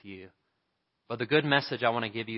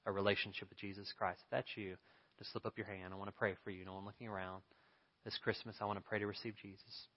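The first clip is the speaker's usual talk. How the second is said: much softer, intimate invitation; gentle altar call; 8 dB quieter than usual. Acted. Pastor invites listeners to receive Jesus, soft and intimate. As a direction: coax them quietly to raise a hand.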